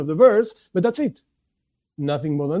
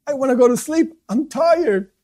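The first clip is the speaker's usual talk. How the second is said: higher-pitched mock plea to sleep; tired complainer; 7 semitones higher than usual. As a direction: lightly play someone protesting they're tired.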